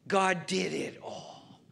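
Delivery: normal talking voice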